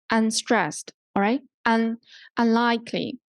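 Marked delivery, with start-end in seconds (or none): none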